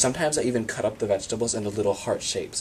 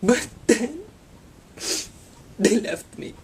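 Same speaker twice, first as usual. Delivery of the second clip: much higher, strained soap-opera lament; melodramatic wail; 13 semitones higher, 4 dB louder than usual. Acted. Abandoned by parents, a hammed-up soap-opera wail.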